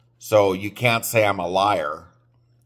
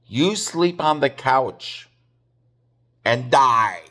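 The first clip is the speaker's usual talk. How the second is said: higher mock mom, giving in with a jab; imitating his mother, pitched up and fed up; mom's voice; 3 semitones higher than usual. Mom telling Joe to sleep on the couch, higher mock mom voice.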